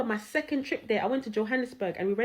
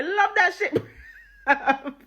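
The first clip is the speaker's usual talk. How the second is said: high, emphatic line breaking into laughter; laughing; 5 semitones higher, 4 dB louder, slower than usual. Real, not acted. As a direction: crack up as you say it. Cracks up at own story.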